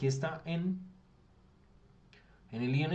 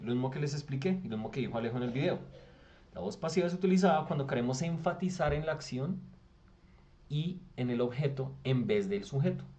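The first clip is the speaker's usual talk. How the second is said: usual speaking voice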